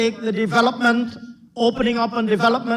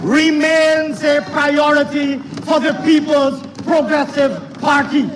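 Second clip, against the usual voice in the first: raised, emphatic political declaiming; forceful parliamentary oratory; 5 semitones higher, 3 dB louder than usual. Real, not acted. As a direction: project each phrase across a noisy chamber.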